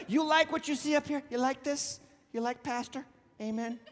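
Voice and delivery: normal talking voice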